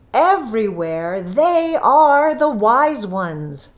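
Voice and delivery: slow, weighty closing words; slow, solemn ending; solemn moral. A narrator's reading. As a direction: deliver the moral slowly and gravely.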